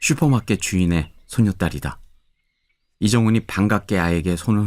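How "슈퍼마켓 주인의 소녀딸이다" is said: ordinary delivery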